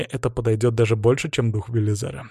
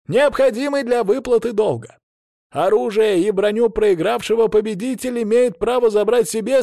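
herald shouting; 9 semitones higher than usual. A narrator's reading. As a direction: call the rules out to the stands. Herald reads duel rules aloud.

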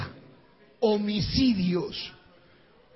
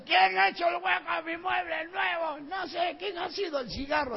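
higher, slurring mock drunk yell; drunk man yelling; 7 semitones higher, 5 dB quieter than usual. Drunk host yelling about the burned hole in his new furniture. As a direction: bellow like an offended drunk host. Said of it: "Y borracho decía".

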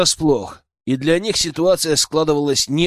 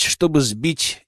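plain reading voice

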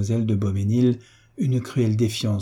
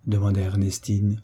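ordinary delivery

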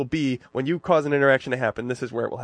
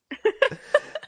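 high squeal laugh. Real, not acted. Big laugh.

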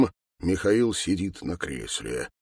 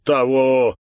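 loud, raised mock echo; mocking mimicry; 4 semitones higher, 6 dB louder than usual. A narrator's reading. Major mimicking the senior sergeant's answer, mocking. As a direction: throw his word back at him, mocking. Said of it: "Майор передразнивает".

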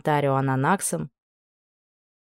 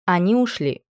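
usual speaking voice

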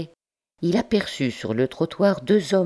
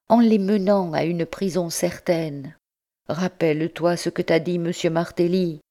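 urgent pleading; 5 semitones higher than usual. A narrator's reading. Brother warning his brother off the fight, pleading urgently. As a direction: plead with a voice pitched higher.

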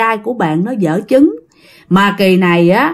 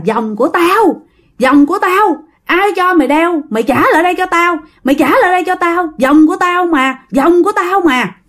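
put-on possessed child screaming; 8 semitones higher than usual. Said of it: "thì tự nhiên nó gào, nó khóc, nó thét".

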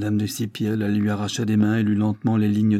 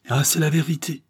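a sudden higher cry of relief; exclaiming; 7 semitones higher than usual. Acted. The Breton seeing the truth, higher exclaiming cry. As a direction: burst out with it. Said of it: "s'écria-t-il".

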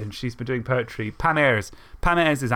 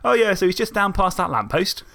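higher-pitched, faint Isle of Wight twang; twingy local accent; 6 semitones higher, 3 dB louder than usual. Shown as a demonstration. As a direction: slip a little Isle of Wight into casual chat. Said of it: "slightly kind of twingy way, but not much".

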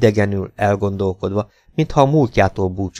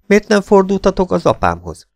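puzzled question; 11 semitones higher, 3 dB louder than usual. A narrator's reading. Son questions friend about father's help.

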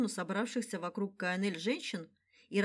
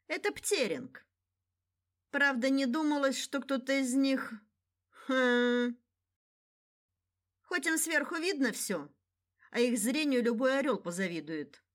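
ambiguous smile; 5 semitones higher than usual. Acted. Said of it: "с некой двусмысленной улыбкой".

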